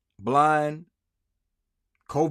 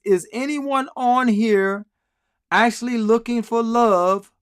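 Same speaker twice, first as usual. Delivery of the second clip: mock sweet reading; 7 semitones higher, 6 dB louder, slower than usual. Reading her profile mockingly.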